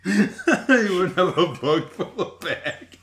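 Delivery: laughing hard while quoting the flub back, slow; mocking replay through laughter. Friend repeats host's tongue-twisted flub, laughing. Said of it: "You were so confident".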